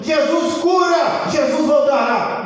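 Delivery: loud, high, drawn-out proclamations; preaching shout. Acted. Stones preach behind the listener, loud slow shouting. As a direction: belt out each cry like a preaching stone. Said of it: "umas pedras pregando".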